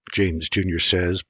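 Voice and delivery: ordinary delivery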